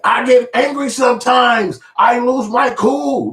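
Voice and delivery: very high, slow, whiny wail; mocking a cohost who excuses his temper; mocking Zoe. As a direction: whine the excuse like a baby.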